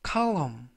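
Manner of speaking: column repeated, clear KAL-um with silent n; model pronunciation. Shown as a demonstration. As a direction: say column again for the class. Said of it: "column이라고 발음하시면 되겠고요". (column 반복 발음 시범)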